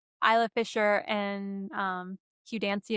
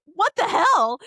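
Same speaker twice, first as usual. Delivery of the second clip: mock exclamation; 9 semitones higher, 6 dB louder, slower than usual. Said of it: "he's like".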